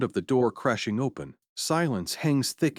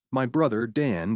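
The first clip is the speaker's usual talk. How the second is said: usual speaking voice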